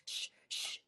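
soft, voiceless shushing; drawn shh sounds; hushing. Acted. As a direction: hush someone twice. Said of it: "the Hatter and the March Hare went".

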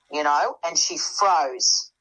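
normal talking voice

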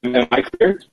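breaking up on a bad line; glitchy jumping pitch. Real, not acted. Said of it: "I can hear you're cutting in and out".

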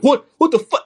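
high-pitched retold outburst of disbelief; mock losing it. Penalized player on the bench reacting to the ref, mock outraged. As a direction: imitate someone flipping out, pitch shooting up. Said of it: "he just lost it".

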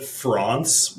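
"France" with a broad ah, like "Frahnce"; pretentious "France", open ah vowel; affected pronunciation. Retold or imitated. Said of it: "the kind of person that calls it France".

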